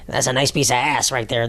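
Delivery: lightly voiced leering old guy; hint of an old man ogling, a little under the voice; mock creepy old man. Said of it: "That's what they talk".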